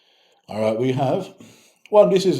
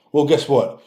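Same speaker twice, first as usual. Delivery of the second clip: usual speaking voice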